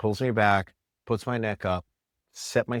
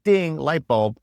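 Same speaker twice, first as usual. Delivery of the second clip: excited; 7 semitones higher, 7 dB louder, slower than usual. Retold or imitated. Retelling his own moment of realization.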